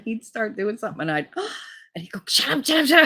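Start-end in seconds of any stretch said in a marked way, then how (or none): 2.3-3.1 high, rapid mock hushing; urgent hushing. Boyfriend hushing her in bed, mock urgent. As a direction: repeat it fast in a high panicky imitation.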